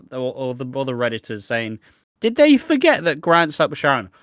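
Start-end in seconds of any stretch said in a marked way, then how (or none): 2.2-4.1 loud, high-pitched mock indignation; mock outrage. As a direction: cry it out like scandalised commenters. Mimics indignant Redditors' complaint.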